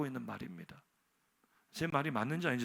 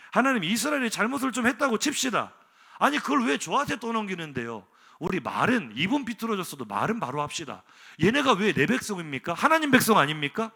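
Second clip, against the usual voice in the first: 1 indignant protest; 5 semitones higher, 8 dB louder than usual. Acted. Preacher acts Moses arguing with God.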